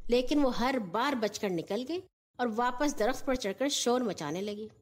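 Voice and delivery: plain reading voice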